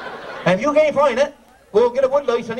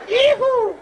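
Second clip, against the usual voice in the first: whooping yee-hoo. Real, not acted. Emcee cheers the volunteer onstage, high whoop. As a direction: whoop like a cowboy.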